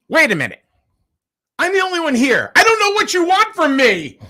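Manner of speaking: high, loud exasperated snap, mimicking the worker; mock the fed-up worker going off on him; irritated worker. Radio host imitating the only worker there snapping at him, high and loud. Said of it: "And she goes".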